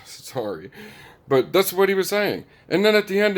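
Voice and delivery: ordinary talk, nothing marked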